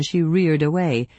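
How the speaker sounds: usual speaking voice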